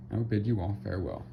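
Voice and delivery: usual speaking voice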